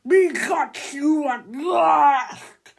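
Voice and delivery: loud, very slow gloating roar; gloating shout. A narrator's reading. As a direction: bellow it out in slow triumph. Booger boy gloating over captives.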